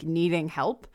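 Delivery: ordinary talk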